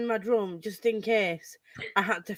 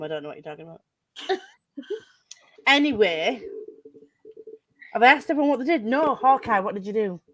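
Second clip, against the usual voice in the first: normal talking voice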